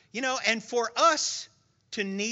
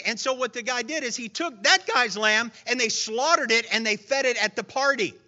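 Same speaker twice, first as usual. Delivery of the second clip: ordinary talk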